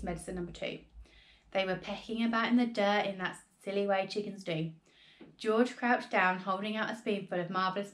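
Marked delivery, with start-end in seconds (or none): none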